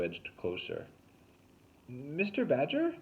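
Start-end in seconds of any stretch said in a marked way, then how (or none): 1.9-2.9 slow, raised call into the woods. A narrator's reading. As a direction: call out cautiously. Calling into brush.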